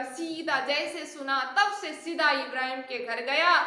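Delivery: ordinary talk, nothing marked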